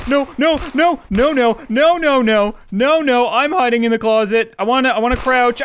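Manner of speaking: high, loud panicked no's; frantic, scrambling to hide. Real, not acted. Panicked, owner found him.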